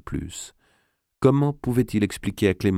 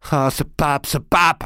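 higher and louder on pape, fists clenched; furious; 8 semitones higher, 3 dB louder, slower than usual. Acted. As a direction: spit out ce pape in rage. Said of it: "en serrant les poings". (colère qui éclate sur « ce pape »)

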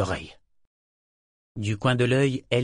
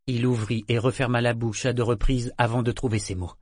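ordinary delivery, nothing marked

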